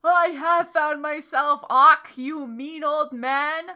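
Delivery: mock angry yell, loud and slow. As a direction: yell it at the old man in hammy rage. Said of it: "Hagrid yelled".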